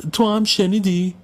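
high, slow frightened question; a child scared by what was heard; trembling with fear. A narrator's reading. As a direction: ask it in fear, drawn out and high.

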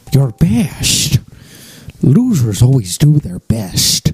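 mock Scottish Connery accent, slow drawl; lightly done Connery accent; Sean Connery impression. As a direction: channel Connery's Scottish lilt. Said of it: "And Sean Connery, without missing a beat".

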